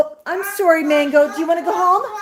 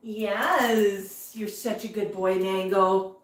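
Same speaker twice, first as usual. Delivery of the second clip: soft, low pet talk; low, tender cooing to a pet; tender praise; 5 semitones lower, 6 dB quieter, slower than usual. Real, not acted. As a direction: praise the bird gently, low and quiet. Praising pet bird as it eats.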